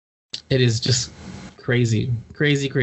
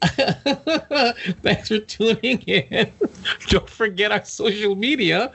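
slow, laughing thanks; laughing sign-off; 5 semitones higher, much slower than usual. Real, not acted. Closing a failed episode, laughing sign-off. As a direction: giggle through the outro.

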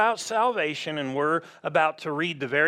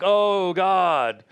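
loud, drawn-out imitated wail; heavy acted moan of prayer; mock lament; 3 semitones higher, 5 dB louder than usual. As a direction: cry out to God in hammed-up guilt. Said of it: "I'm not like".

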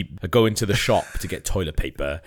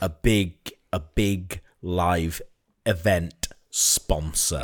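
slow dramatic build-up; 3 semitones lower, much slower than usual. Pretend. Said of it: "dramatic slow build-up".

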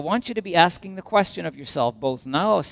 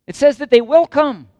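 emphatic preaching; 9 semitones higher, 7 dB louder than usual. Acted. Preacher hammering the point.

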